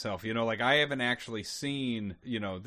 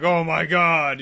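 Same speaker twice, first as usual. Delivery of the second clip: loud, higher cry of horror; shocked outburst; 4 semitones higher, 11 dB louder, much slower than usual. Acted. Soldier having flashbacks in the backyard, shocked outburst. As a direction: exclaim it at full volume, appalled. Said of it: "like, triggering, flashbacks from my wartime".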